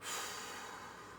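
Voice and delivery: long unvoiced breath blown out; blowing out breath. Shown as a demonstration. Teacher demonstrating a calming breath, blown out. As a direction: blow the anger out in one long breath. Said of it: "Let's blow it out".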